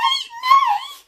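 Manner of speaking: very high, loud shriek; terrified scream. Acted. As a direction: scream in terror. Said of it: "screamed Red".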